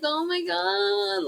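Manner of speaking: slow, high, drawn-out oh my God; a stretched, scared gasp at the scope screen; dreading gasp. Retold or imitated. Dread entering the surgical room.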